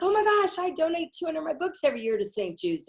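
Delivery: high, bright burst of delight, then settling; excited gush. Retold or imitated. To her companions, passing Saint Jude, high excited gush. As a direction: squeal it like you just spotted a hero.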